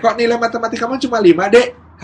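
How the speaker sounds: high, quick, loud questioning; questioning parent. Acted. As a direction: act a parent querying a poor grade. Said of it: "kelas dua S M P ditanya". (orang tua bertanya dengan nada tinggi)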